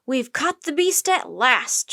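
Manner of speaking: loud, slow shout; drawn-out loud proclamation. A narrator's reading. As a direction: call it out slowly in triumph. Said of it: "shouted Joe".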